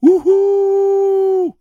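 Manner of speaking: very high, loud joyful whistle hoot; long joyful train whistle wouhou. A narrator's reading. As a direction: let the steam whistle sing out. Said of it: "son joyeux cri".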